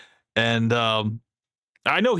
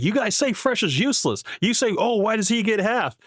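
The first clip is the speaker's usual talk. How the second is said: higher-pitched mock quote; lightly put-on higher voice, as if quoting; imitating Myron; 8 semitones higher than usual. Host mimicking Myron blaming the haters, imitation. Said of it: "You know, it's like".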